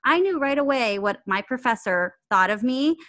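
ordinary delivery

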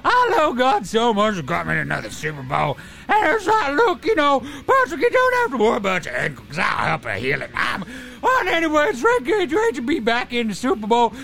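high, strained put-on voice; Patrick Mahomes. Quarterback calling in to intro the show, high-voiced impression. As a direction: play an athlete's radio interview in a high voice. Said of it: "Patrick Mahomes steps up. He jumps on the show".

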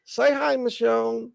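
cooing at cat. Real, not acted. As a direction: coax the cat into saying hi.

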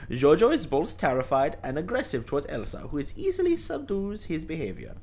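hammed-up German accent, rising to loud. Podcast host reading the plot in a put-on German accent. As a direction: read the synopsis in an overblown German accent. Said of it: "Can we stop doing".

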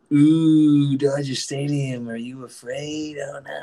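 high, slow mocking singsong; drawn-out mock concern; mocking the doubters. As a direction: tease like a fake-worried friend. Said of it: "everyone's like".

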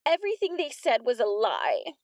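put-on high tattling girl voice; high, eager snitch; whiny Polly. A narrator's reading.

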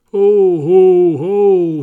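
booming, very loud ho ho ho; loud Santa ho ho ho. A narrator's reading. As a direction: bellow it like a giant Santa. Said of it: "he yelled loudly".

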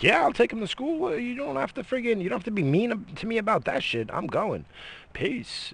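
mock cheerful reply. Husband answering his wife, high sing-song mock cheer. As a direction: play it breezy and falsely sweet. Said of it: "I'm like".

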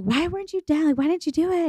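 mock questioning friends. Friends asking, high.

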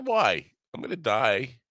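normal talking voice

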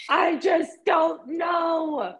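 high, drawn-out frustrated cry; exasperated wail. Retold or imitated. Acting out an outburst at mom, exasperated wail. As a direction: stretch it out high, at wits' end.

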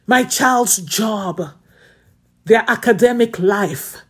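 slow, emphatic intercession; fervent prayer. Acted. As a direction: lay each need before God.

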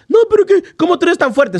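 high panicked voice of the worried wife; frantic wife. Retold or imitated. Wife at the clinic, panicking, high-pitched.

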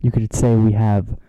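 dramatic announcement. Acted. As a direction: announce it like a heroic entrance. Dramatic podcast return, Avengers pun.

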